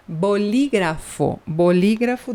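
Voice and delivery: "Bolígrafo" said slowly, syllable by syllable; teacher drawing out a vocabulary word; slow spelled-out word. Shown as a demonstration. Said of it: "bolígrafo é caneta".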